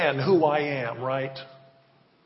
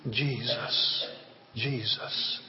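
ordinary talk

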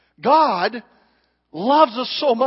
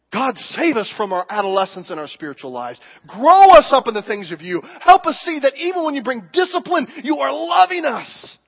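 prayer; 3 semitones higher, 4 dB louder than usual. Acted. Praying from the pulpit.

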